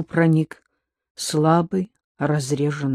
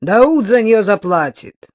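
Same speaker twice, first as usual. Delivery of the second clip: louder, irritated snap; irritated shout; 5 semitones higher, 8 dB louder than usual. A narrator's reading. Paul continues irritated.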